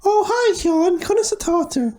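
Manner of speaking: high put-on schoolgirl voice; acting as Mary. Mary greets Sean in an oral exam skit, high voice. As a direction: play Mary greeting Sean in stilted Irish. Acted Irish exam dialogue.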